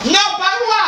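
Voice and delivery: very high-pitched outcry; hammed-up high protest; mock protest. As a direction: squeal the refusal in alarm. Acts out exclaimed protest.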